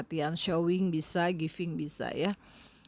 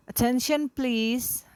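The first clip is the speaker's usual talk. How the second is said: teacher calling class; 6 semitones higher, 5 dB louder, slower than usual. Shown as a demonstration. Teacher calming noisy class.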